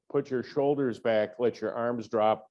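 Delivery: ordinary delivery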